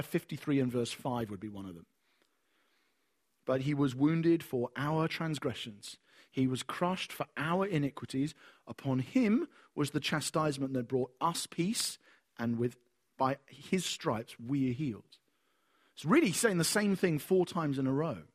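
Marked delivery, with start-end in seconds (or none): 3.4-15.2 slow, solemn scripture reading. Acted. Preacher reading Isaiah to the congregation, slow solemn reading. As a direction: read the verse slowly and with weight.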